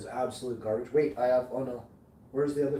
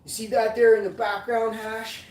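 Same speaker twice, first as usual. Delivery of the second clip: put-on Squish's Michigan accent again, louder and higher; 9 semitones higher, 7 dB louder than usual. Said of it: "This is Squish's kind of accent".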